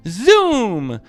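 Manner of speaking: usual speaking voice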